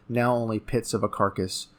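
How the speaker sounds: plain reading voice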